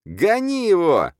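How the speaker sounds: very high, slow, drawn-out order; furious shout. A narrator's reading. Editor ordering the khan thrown out, furious. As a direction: yell it out at full pitch. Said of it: "озлился Ещенко".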